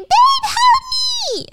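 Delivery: very high, slow, loud scream; screaming for help. Retold or imitated. Stacy screaming to her husband for help, very high. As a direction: shriek it out in panic. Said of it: "Stacy screaming".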